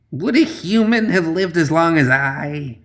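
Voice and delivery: put-on high, slow, spitting old crone; spat hag voice. A narrator's reading. Old hag spitting back at the slumped man. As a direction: spit the words like an ancient hag. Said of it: "she spat".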